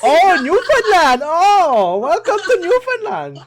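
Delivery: put-on very high, loud, drawn-out Newfoundland accent; over-the-top high Newfoundland welcome; mock Newfoundland accent. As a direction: ham up a terrible Newfoundland accent. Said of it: "I do Newfoundland accent".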